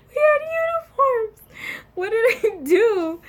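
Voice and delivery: high, loud comic protest; exasperated disbelief. Retold or imitated. Storyteller baffled at what offended the teacher, exasperated.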